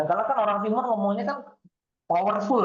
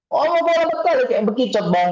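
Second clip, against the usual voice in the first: higher and louder mock scolding; loud teasing; 7 semitones higher, 7 dB louder than usual. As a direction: tease him loudly for talking like a snail. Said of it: "cewek yang terbiasa".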